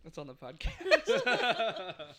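laughing. Real, not acted. Friend laughs out a joke to the group, slow and laughing. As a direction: talk while still cracking up. Says it while laughing hard.

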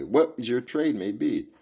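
plain reading voice

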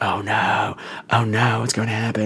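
low, understated sarcastic worry; deadpan fake alarm; mock dread.

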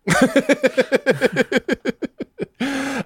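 high, rapid giggling run; long laugh. Real, not acted. Host amused by co-host's accent stunt, long high laugh.

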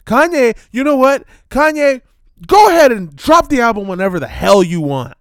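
loud, high exasperated yell. Acted. Shouting at [name] directly.

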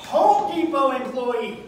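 mock announcement. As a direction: boom it out like a label. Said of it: "apron that says".

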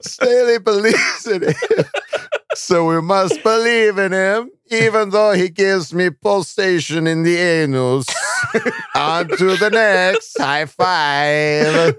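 put-on slow comic Kazakh accent, cracking up; mock Kazakh accent. Host losing it over a listener's joke, mock Kazakh accent. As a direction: keep the fake Kazakh voice while breaking into laughter.

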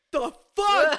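hammed-up angry dad; stepdad's outburst. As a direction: stepdad smelling the fart, loud mock outrage. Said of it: "her stepdad goes".

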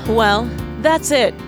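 ordinary talk, nothing marked

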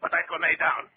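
high, forceful command; officer shouting at a man holding a grenade; shouted order. Acted. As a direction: make him obey right now.

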